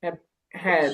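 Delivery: ordinary delivery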